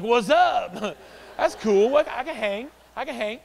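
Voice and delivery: high, loud laughing bro voice; playing it cool with a laugh; loud chuckling cool guy; mock cool dude. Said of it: "I'm like".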